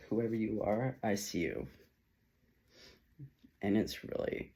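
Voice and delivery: ordinary talk, nothing marked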